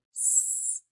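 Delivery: s hissed soft and long; drawn-out s like a snake. Shown as a demonstration. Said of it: "the sound that sounds like a s".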